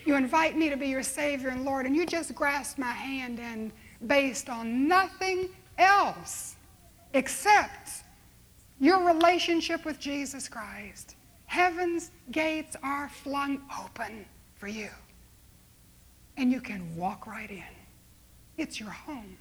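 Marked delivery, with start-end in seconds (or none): 3.4-14.9 parola per parola, lento e deciso; slow emphatic climax. Real, not acted. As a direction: scandisci piano, come un annuncio solenne. Sermon climax.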